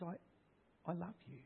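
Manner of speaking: God softly reassuring a hurting listener; God's gentle voice. Acted. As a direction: speak as a comforting God, hushed. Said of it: "He says".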